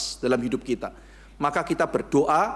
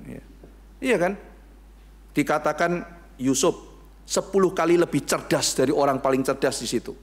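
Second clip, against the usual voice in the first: ordinary talk, nothing marked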